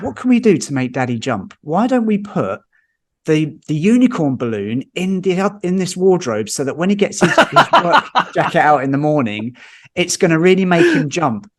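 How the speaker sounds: mock-excited, lifting the pitch on the plan; retold scheming. Family on the sofa plotting to make Daddy jump, retold scheming.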